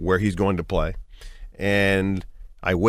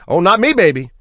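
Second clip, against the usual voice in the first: high, louder jazzy cool-cat line; father's TV line; 9 semitones higher, 7 dB louder than usual. Retold or imitated. Father on The Cosby Show, louder high cool-cat line. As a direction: toss it off like a hip old musician. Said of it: "he says this line".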